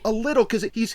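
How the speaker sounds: normal talking voice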